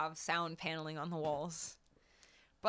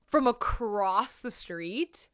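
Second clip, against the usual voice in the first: retold disbelief; 3 semitones higher, 6 dB louder, much slower than usual. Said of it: "And I was like".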